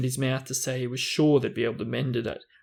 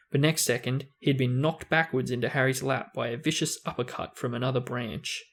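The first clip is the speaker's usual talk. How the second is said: usual speaking voice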